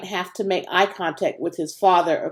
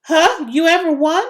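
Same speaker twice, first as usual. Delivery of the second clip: high, louder eager questions; excited girl voice; 9 semitones higher, 5 dB louder than usual. Acted. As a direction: push him with eager curiosity. Virginia excited about race.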